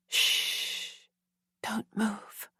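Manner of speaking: breathless hush to a hurt woman; hushing someone injured, barely voiced; soothing whisper. A narrator's reading. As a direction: whisper her still, gently. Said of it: "That voice, smooth, sweet".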